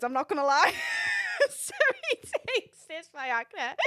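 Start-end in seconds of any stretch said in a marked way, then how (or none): 0.8-3.7 high, squeaky laughing speech; helpless giggles breaking into the words; cracking up. Real, not acted. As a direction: fight through laughter to tell it. Cracking up telling the story.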